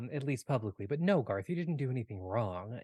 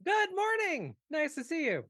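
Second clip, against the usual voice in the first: high, loud sing-out greeting; loud shouting; 4 dB louder than usual. Acted. Chipper character greets hungover friends, shouting. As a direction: call it out piercingly high and loud. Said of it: "they are shouting really loud".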